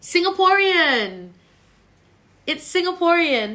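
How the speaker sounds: high, loud, drawn-out proclamation; stretched-out exclamation to the audience; emphatic announcement. Real, not acted. Answering viewers asking about accent.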